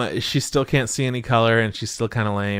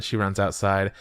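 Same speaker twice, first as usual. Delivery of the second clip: normal talking voice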